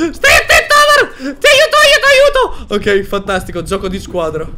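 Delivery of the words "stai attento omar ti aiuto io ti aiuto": high, loud, laughing shouts of warning; panicked laughing yell. Real, not acted. Gamer shouting to teammate Omar, laughing panic.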